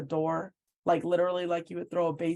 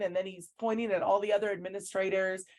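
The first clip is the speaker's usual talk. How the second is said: normal talking voice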